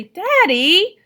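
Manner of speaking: high, loud embarrassed Daddy; embarrassed protest. A narrator's reading. Daughter embarrassed by her father, loud protest. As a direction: cry out in mortified protest.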